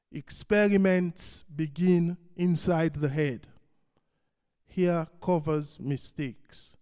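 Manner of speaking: projected, deliberate recital, each line set apart; poetry reading. Acted. Poet eulogizing King in a poem, measured recital. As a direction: declaim it line by line, pausing after each.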